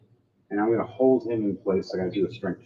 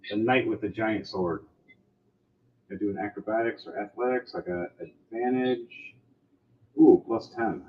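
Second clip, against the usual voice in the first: ordinary talk, nothing marked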